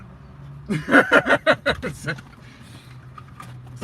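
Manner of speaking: loud, high burst of laughter; high laughter tailing away. Real, not acted. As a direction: crack up at your dog's bad luck. Laughs at dog's bad luck.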